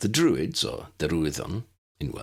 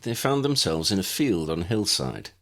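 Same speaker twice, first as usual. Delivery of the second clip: usual speaking voice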